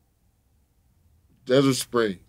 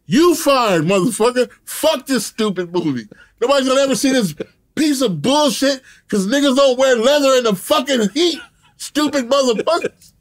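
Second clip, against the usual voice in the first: retold cussing rant; 10 semitones higher than usual. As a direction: blow up at the producer on set. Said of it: "I'm talking the gang of shit".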